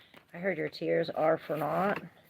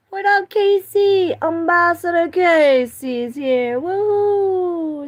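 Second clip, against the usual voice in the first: much higher, loud hype shout-out; excited greeting; 12 semitones higher, 14 dB louder, slower than usual. Real, not acted. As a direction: hype up the viewer joining the stream. Greeting a viewer by name.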